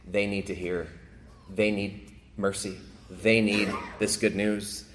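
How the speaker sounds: quiet, earnest conviction; soft earnest plea. Acted. Voicing Jesus's unspoken intent.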